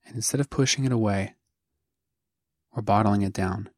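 spare phrases with long silences between; guided meditation. Acted. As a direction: guide the listener gently, leaving room after each phrase. Begins leading the guided meditation.

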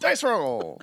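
loud hammed-up battle cry; shouted battle cry. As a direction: yell it like an anime attack. Shouting the anime move.

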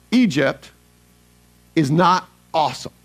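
normal talking voice

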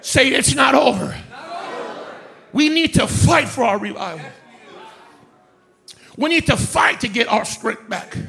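rising, shouted sermon cadence; full force preaching. Acted. Pastor rousing the congregation, preaching at full force. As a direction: stir the church up, voice ringing.

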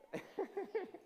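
high greedy cackle; high villain cackle. Pretend. Gloating over chocolates, high mock cackle. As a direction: cackle like a miser.

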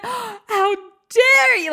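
gasped, drawn-out how dare you; slow, wounded, high mock outrage; mock betrayed gasp. Son's betrayed shock.

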